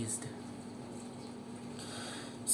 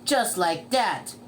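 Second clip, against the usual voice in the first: usual speaking voice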